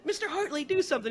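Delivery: high mock plea. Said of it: "I love when he said".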